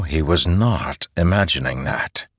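ordinary delivery

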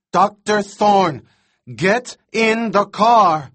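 high, loud, slow pleading yell; desperate shout. A narrator's reading. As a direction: beg at the top of your lungs. Arby pleading over the radio.

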